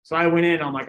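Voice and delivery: ordinary delivery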